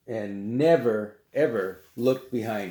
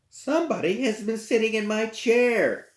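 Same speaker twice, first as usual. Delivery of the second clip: much higher mama bear voice; pitched-up, motherly bear line; middle-sized bear; 12 semitones higher than usual. Acted. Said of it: "said the middle-sized bear".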